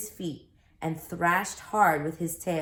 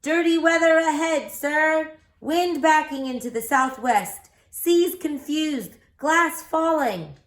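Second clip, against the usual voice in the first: called out; 9 semitones higher, 7 dB louder than usual. Acted. Stewart calls to the Wasp's owner on shore, a loud high warning. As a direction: shout a warning across the water. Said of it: "called out".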